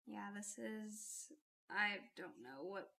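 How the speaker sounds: usual speaking voice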